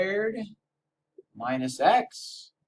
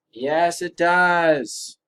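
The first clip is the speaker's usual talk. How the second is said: slow emphatic; 4 semitones higher, 8 dB louder, much slower than usual. Acted. Answer confirmed, drawn out.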